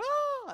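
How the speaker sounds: put-on high, loud playful kid scream; kids' scream. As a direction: shriek like children tearing past. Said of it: "run past my office and go".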